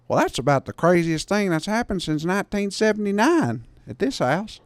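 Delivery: a hint of a Southern old lady; lightly voiced old lady. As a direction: tell it like a chatty grandma.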